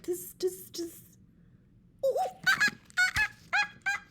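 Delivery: squealing ooh-ah monkey imitation; monkey chatter. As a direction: mimic monkeys hooting back. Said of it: "monkeys only stomped both of their feet".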